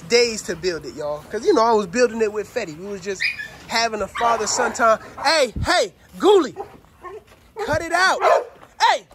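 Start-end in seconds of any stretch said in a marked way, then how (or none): 5.2-9.1 loud, higher warning call; yelling at dog to quit; scolding the dog. Real, not acted. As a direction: stop him mid-act.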